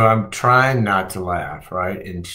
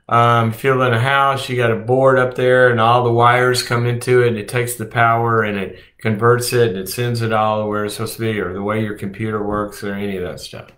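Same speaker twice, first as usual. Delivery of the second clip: normal talking voice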